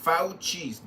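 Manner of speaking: Fauci repeated as FOW-chee; corrected pronunciation. Shown as a demonstration. Drilling the name for another speaker, Fauci as FOW-chee. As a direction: repeat Fauci with a clear "ow" sound. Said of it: "His name is pronounced".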